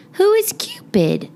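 high, wondering question; curious. Acted. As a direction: ask in astonishment. Girl's surprised question.

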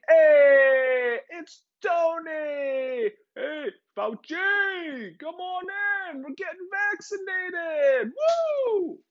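very high, shouted cheery welcome; gleeful nerdy greeting. Pretend. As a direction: call out like a giddy host. Gates greets Fauci gleefully.

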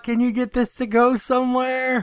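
Wheeljack shouting. Retold or imitated. Wheeljack pleading with Metroplex, high desperate shout. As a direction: yell up at the giant like a lost friend. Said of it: "imagine Wheeljack with that accent just shouting".

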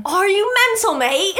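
high, loud mock British accent; hammed-up British scold; playful British accent scoff; mock British outrage.

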